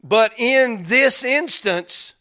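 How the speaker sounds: full-volume preaching peak; preaching at full force. Acted. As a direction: drive the sermon home with fire. Strained slow preaching.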